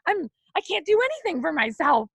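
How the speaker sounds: high-pitched, overwrought despair; reenacted emotional meltdown, pitch soaring; having a meltdown. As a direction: spiral upward in self-blame. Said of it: "Just, like, having a moment".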